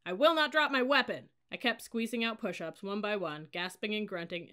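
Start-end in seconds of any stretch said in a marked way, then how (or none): 0.0-1.3 high, loud, straining for volume; shouted recitation. A narrator's reading. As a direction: yell it with full commitment. Cadet shouting during push-ups.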